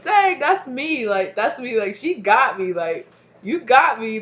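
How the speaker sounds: loud, laughing through it; belly-laughing ancestor, slapping a leg; loud booming laughter; laughing hard. Retold or imitated. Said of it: "they're laughing so hard".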